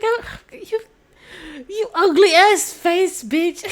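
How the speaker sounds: laughing too hard to say it straight; laughing insult. Real, not acted. Vlogger voicing Jasmine's comeback while cracking up.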